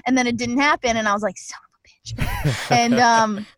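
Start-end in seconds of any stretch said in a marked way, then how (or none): 1.4-2.7 slow disappointed whispered curse. Retold or imitated. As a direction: mutter the curse under your breath.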